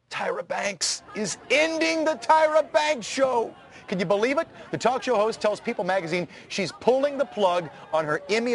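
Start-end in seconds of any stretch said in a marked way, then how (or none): 0.1-3.5 mock dramatic.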